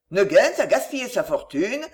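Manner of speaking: ordinary delivery, nothing marked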